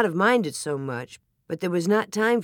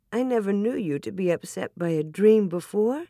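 ordinary delivery

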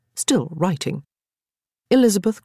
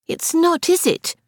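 put-on much higher, anxious child voice; worried little girl; 13 semitones higher than usual. A narrator's reading. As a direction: fret like a small girl.

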